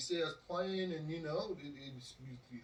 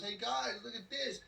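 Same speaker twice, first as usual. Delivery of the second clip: higher-pitched cocky call to friends; showing off; 7 semitones higher than usual. Retold or imitated. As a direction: show off to your mates. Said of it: "being the show off that he is".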